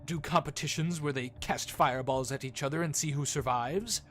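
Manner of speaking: proud gambler, bright and pushy; a showman selling the contest; boastful showman voice. Acted. Fester keeps talking.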